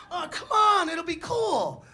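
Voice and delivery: put-on high, pleading kid talking a pal into it; wheedling coax. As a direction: whine it high and loud to win him over. Narrator voices himself coaxing the friend.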